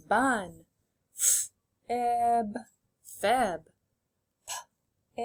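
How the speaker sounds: "N, ut" held apart, then blended "nut"; sounding out, blending. Shown as a demonstration. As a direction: pop the "p", pause, say "it", then join as "pit". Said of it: "Say them out loud with me".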